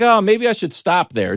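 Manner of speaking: high, loud eager musing. Retold or imitated. Driving past the house with his son: loud eager thought. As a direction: perk up at the idea. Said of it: "I was like".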